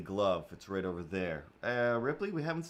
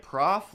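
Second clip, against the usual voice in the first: Prof said louder and higher; sounding out prof; 7 semitones higher, 7 dB louder than usual. Retold or imitated. Explaining the name choice, Prof said loud. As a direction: punch out Prof. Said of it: "I was like".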